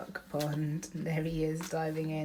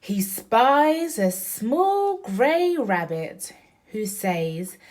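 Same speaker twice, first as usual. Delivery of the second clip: louder, slow, sing-song picture book reading; reading to a child; 4 semitones higher, 10 dB louder, slower than usual. Acted. Mom reading Splosh to Oriana, loud and slow. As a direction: read each line big and slow for a little one.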